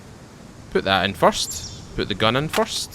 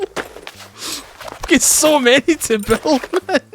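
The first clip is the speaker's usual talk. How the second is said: laughing; 14 semitones higher, 3 dB louder, much slower than usual. Real, not acted. Laughing at his unbuilt backlog.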